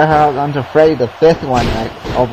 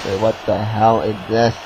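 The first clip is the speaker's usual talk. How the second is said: normal talking voice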